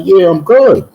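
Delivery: very high retold reply; high-pitched insistence. Insisting to his doubting cousin, very high-pitched reply.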